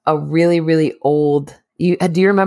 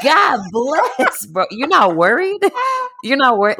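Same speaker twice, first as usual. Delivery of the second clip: higher, incredulous; mock disbelief; 9 semitones higher than usual. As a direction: raise it in amazed disbelief. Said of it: "And I'm like".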